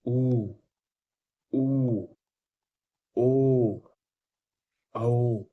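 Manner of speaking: ऊ ऊ ओ औ called out loud and held; vowel demo. Shown as a demonstration. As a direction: model the back vowels loudly. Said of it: "जैसे". (पश्च स्वर ऊ ओ औ ज़ोर से खींचकर बोलें)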